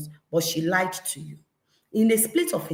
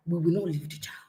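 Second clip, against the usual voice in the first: ordinary delivery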